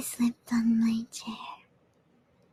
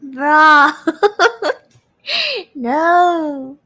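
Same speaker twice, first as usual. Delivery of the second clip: breaking up with laughter; can't stop laughing; 3 semitones higher, 8 dB louder, much slower than usual. Real, not acted.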